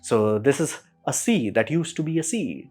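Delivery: normal talking voice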